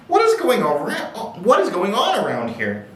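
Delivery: high, worn-out wail of disbelief; exasperated groan. A narrator's reading. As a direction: groan it out, high-pitched and fed up. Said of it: "groaned the exhausted girl".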